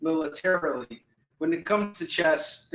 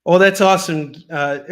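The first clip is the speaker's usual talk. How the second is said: usual speaking voice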